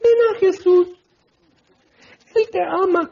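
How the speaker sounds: very high, crooning mock altar call; soft evangelist voice. Preacher imitating a call to come to Jesus, soft evangelist voice. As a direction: float it up high like a sweet invitation. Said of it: "ya estás en su voz así".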